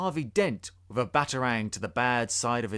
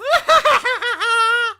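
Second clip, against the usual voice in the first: much higher, loud maniac laugh; evil cackle; 12 dB louder than usual. Acted. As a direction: cackle like a madman. Joker after his threat.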